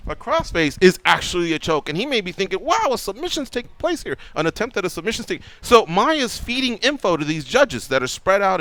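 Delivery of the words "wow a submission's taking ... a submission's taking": light "wow" of an imagined judge; acted amazement. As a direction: play an impressed, naive onlooker. Imagined boxing judge being impressed.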